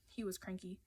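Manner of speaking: ordinary delivery, nothing marked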